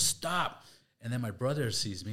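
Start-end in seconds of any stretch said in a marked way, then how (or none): 0.0-0.6 higher, sharp command; mock police order; cop command. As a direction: bark it like a cop. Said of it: "he goes".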